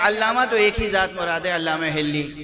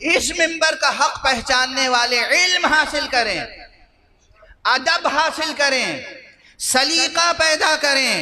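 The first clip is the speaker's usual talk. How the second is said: high, slow, ringing pulpit appeal; solemn urging from the pulpit, drawn out; preacher's exhortation; 7 semitones higher, slower than usual. Real, not acted. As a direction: exhort them phrase by phrase, pitched high.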